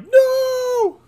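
high, loud, crying shout; terrified scream. Retold or imitated. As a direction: scream in horror. Abductee sees the probe.

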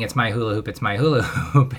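normal talking voice

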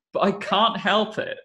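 ordinary talk, nothing marked

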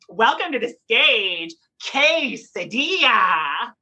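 slow, drawn-out drag show introduction; stage announcer. Acted. Drag queen inventing a pun name at dinner, mock announcer. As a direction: stretch "Quesadilla" like a grand stage name. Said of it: "you're like".

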